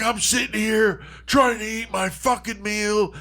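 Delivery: high-pitched mock shout; booming outrage. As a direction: act out a friend's staged restaurant tantrum. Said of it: "really deep booming fucking voice".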